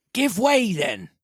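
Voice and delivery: high sharp command; captain crying out to row; cried order. Acted. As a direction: urge the rowers on. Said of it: "cried the captain".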